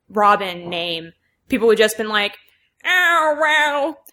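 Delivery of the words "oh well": high nasal nerd whine; whiny fanboy complaint. Shown as a demonstration. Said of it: "my nerd voice sounds like Snarf".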